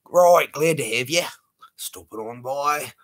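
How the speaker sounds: a light, hammed-up Australian voice; mock Aussie accent. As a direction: greet a fan like a cheery Aussie bloke. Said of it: "My accent's going".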